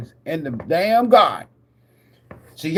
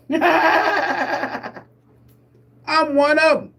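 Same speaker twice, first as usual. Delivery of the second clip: laughing boast; 9 semitones higher than usual. Real, not acted. Boasting on live stream.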